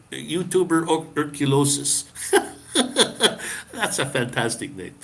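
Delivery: usual speaking voice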